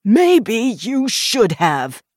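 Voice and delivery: slow, high, loud retort; angry shout. A narrator's reading. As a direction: shout it back accusingly, drawing out the words. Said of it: "Watkins shouted".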